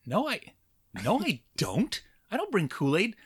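put-on high, childlike protest; little boy protesting. Accused boy denying to the teacher, high childish protest. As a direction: squeak out your innocence as a kid. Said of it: "And I thought".

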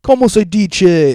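slow, high, hammed-up Spanish phrase; loud, slow mock Spanish question. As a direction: play the ignorant tourist asking. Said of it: "I'd be like".

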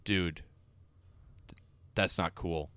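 low, soft hurt scolding; a mild mock "not cool" scold; quiet offended woman, low and soft; offended quiet rebuke. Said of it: "she just stares right at him and goes".

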